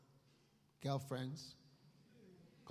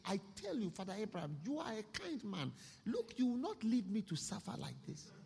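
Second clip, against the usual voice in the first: higher, pleading rich man begging for relief; desperate pleading; 6 semitones higher than usual. Acted. Rich man in hell begging Father Abraham: higher, desperate plea. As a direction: beg Abraham like a man in torment.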